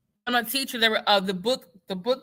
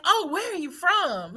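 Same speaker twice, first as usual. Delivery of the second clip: higher, slow prissy mock question; mocking questioner; 9 semitones higher than usual. Imitating a nosy stranger asking her origins, higher and slow. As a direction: ape the nosy asker.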